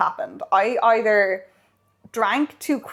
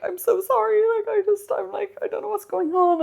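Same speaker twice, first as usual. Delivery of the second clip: very high, squeaky panicked apology; mock distress; 12 semitones higher than usual. Retelling her apology to her date, mock distress. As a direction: imitate yourself falling apart, pitched right up.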